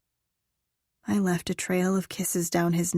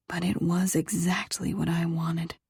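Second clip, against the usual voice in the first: usual speaking voice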